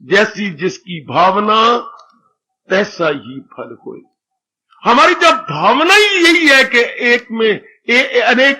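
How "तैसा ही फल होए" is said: ordinary talk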